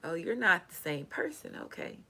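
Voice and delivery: breathless whisper of alarm. Retold or imitated. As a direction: whisper it as if someone might hear. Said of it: "I was just like".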